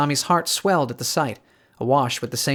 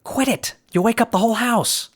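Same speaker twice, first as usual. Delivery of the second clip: sharp shush; 10 semitones higher than usual. A narrator's reading. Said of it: "shushed her sharply".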